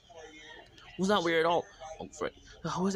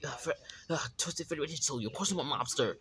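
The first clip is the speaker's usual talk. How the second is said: normal talking voice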